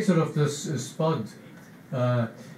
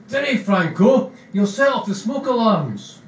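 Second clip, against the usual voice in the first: higher, louder nervous plea; Spud warning; 7 semitones higher, 4 dB louder than usual. Acted. Spud telling Begbie not to smoke, higher and louder. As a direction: warn Franco off lighting up. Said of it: "So I goes".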